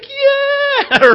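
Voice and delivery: put-on very high squeal of a cheering kid; imitated cheer. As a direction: squeal back in triumph. Daughter cheering back.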